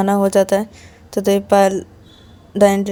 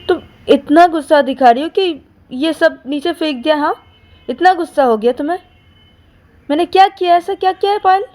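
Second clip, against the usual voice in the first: much higher, rising indignant questions; indignant disbelief; 9 semitones higher, 4 dB louder than usual. Acted. Ananya confronts Payal on the bed, indignant and hurt. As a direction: demand to know what she did wrong.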